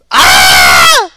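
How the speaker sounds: loud scream of dismay; real scream. Real, not acted. Gamer losing the fight, screaming. Play fails in game.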